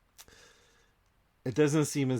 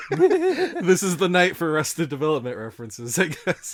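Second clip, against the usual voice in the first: laughing; 7 semitones higher, 9 dB louder than usual. Real, not acted. Laughing at his own joke.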